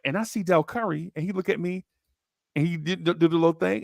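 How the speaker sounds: normal talking voice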